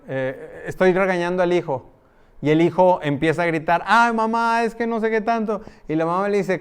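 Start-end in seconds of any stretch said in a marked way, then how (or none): 3.9-5.6 put-on whiny child. Child shouting back at mother.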